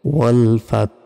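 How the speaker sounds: ওয়াল ফাত with a breathy h after the t; hint of ha. Shown as a demonstration. Teacher demonstrating the final letter, ওয়াল ফাত with exhaled h. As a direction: let ওয়াল ফাত end in a puff of h. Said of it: "এভাবে".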